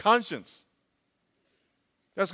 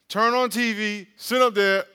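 normal talking voice

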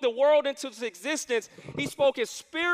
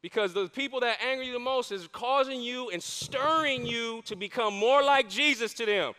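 usual speaking voice

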